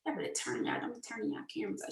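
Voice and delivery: unvoiced, fast muttered aside; whispered aside. Real, not acted. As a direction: whisper to yourself while clicking. Aside while operating call.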